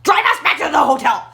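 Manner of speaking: high, strained retold outburst; exasperated yelling. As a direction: re-enact scolding a panicking boyfriend. Exasperated order to panicking boyfriend.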